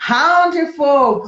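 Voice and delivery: how de fogo said slow and slurred, regional English; regional drawl. Shown as a demonstration. Teacher showing a regional English accent, slow and slurred. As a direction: slur how are you into how de fogo. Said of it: "Tem lugar que fala".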